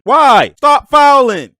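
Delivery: loud, high protest; yelling at the refs. Real, not acted. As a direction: shout it out in disbelief. Yelling at players to stop fouling.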